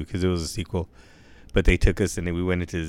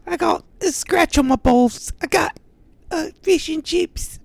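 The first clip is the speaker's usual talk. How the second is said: much higher, slow squeaky cartoon English accent; mock English accent; 5 dB louder, slower than usual. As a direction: screech it like a cockney caricature.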